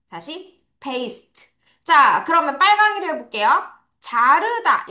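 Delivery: usual speaking voice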